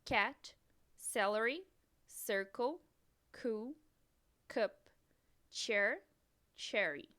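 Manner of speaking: each English word said clearly with pauses; teacher reading a vocabulary list in English; English model words. Shown as a demonstration. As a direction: model the English pronunciation for repeating. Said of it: "Vamos ver".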